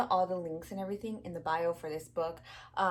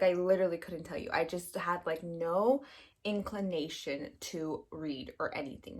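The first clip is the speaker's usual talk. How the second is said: ordinary talk, nothing marked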